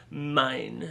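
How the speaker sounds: lightly mocked French-accented "mine"; mimicked French accent. Host teasing his guest about her accent, mimicked French accent. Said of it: "Do you, do you hear this?".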